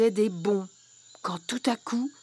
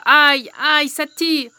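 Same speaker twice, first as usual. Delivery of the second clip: pained yelp; 3 semitones higher, 8 dB louder, slower than usual. Acted. Hair caught in brambles.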